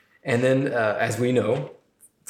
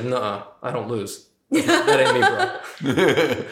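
cocky Mat voice; 3 dB louder, slower than usual. Retold or imitated. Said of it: "And he's like".

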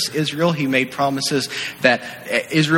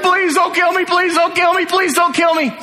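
pleading; 13 semitones higher than usual. Retold or imitated. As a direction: beg for your life, shrill with panic. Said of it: "he's like".

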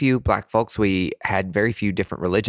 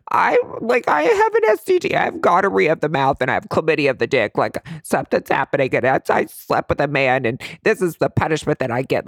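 panicked outburst; 6 semitones higher than usual. Retold or imitated. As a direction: blurt out a frantic confession to the nurse. Panicking about an STD.

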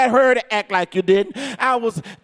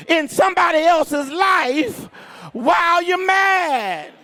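raised, higher preacher's shout; full-force preaching; 5 semitones higher than usual. Acted. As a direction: ring it out over the congregation.

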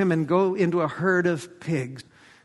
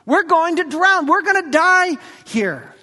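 panicked cry; 7 semitones higher, 4 dB louder than usual. Acted. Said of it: "they cried out to Jesus".

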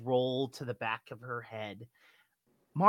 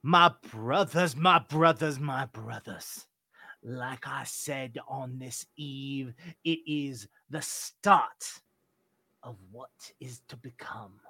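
grand sermon fading at the end; cult father preaching; 4 dB louder than usual. Acted. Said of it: "you hear him".

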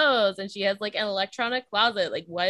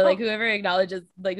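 ordinary talk

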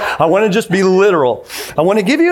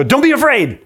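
a mock sudden shout at the daughters; jump-scare shout; 7 semitones higher than usual. Dad springing out at daughters in the hallway, playful shout. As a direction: pop out with a playful yell. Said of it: "I'd just jump out and go".

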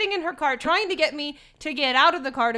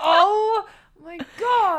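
slow, louder laugh-cry groan; laughing despair; 5 semitones higher, 6 dB louder, much slower than usual. Retold or imitated. Looking at the ridiculous outfit, laughing despair. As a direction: moan it through a laugh.

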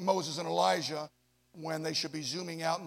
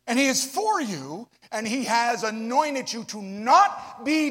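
slow, loud, rising sermon climax; fervent full-voice preaching; preaching at full force; 4 semitones higher, 11 dB louder, slower than usual. Acted. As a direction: proclaim it over the congregation.

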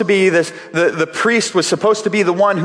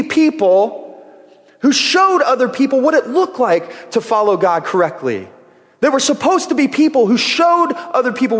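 preaching at full force; 5 semitones higher than usual. Acted. Pastor drives his point home to the congregation, preaching. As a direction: build the sermon to its peak.

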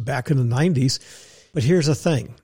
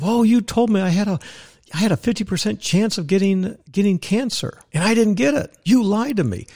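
much higher, whiny mock accusation; mock complaint; 7 semitones higher than usual. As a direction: parody a sensitive patient. Mocking oversensitive patients.

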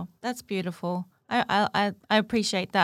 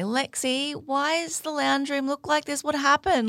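pitched up, demanding why of a messy room; a parent scolding, asking why accusingly; accusational tone; 5 semitones higher than usual. Retold or imitated. Parent to daughter about the lounge room, accusing. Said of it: "in an almost accusational tone".

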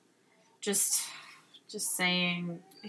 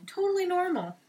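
sarcastic; 9 semitones higher than usual. Real, not acted. Sarcastic remark.

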